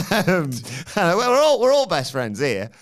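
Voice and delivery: hammed-up friendly reassurance; mock friend voice. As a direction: keep the friends' voice going. Voicing friends in retold banter.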